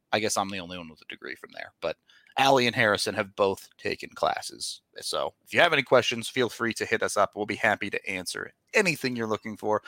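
normal talking voice

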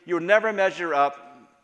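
loud accusing voice; a hint of a condemning devil; Satan accusing. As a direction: throw the accusation like a condemning enemy. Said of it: "where Satan is saying".

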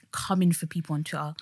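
hushed aside; whispering. Real, not acted. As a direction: drop to a whisper. Whispered aside about Twitter.

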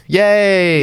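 high mock yay; sarcastic cheer. Mocking the feel-good ending, high sarcastic cheer.

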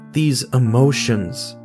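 ordinary delivery

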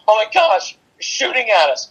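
high-pitched mock panic; panicking captain. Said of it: "stay cool and calm under pressure".

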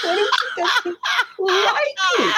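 high wail broken by laughter; hammed-up high plea; mock weeping. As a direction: imitate a tearful new mother, laughing through it. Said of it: "You were crying and weeping".

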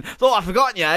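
Pierce Brosnan impression. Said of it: "when I mention".

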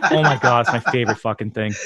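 usual speaking voice

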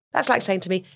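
normal talking voice

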